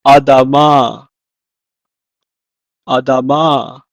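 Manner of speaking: Adama said slowly, syllable by syllable; Hebrew pronunciation. Shown as a demonstration. Teacher models a vocabulary word, careful Adama in Hebrew. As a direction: model "Adama" clearly, then repeat it. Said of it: "In Hebrew, it's pronounced as".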